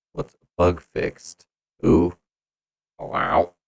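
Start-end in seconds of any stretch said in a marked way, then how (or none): none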